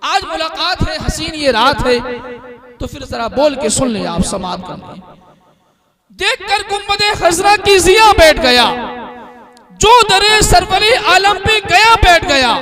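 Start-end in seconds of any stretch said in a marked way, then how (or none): 6.2-12.5 raised, ringing poetry recitation to a crowd; recited verse. Acted. Announcer reciting the sher to the crowd, raised and ringing. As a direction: declaim the couplet for the gathering.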